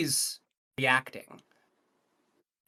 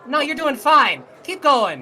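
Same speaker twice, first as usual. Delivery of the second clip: loud, high false encouragement; wizard calling out; 11 semitones higher, 9 dB louder than usual. Acted. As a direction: call down cheerily to push him on. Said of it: "the redhead wizard says".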